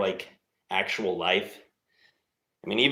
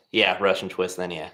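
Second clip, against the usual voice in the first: ordinary talk, nothing marked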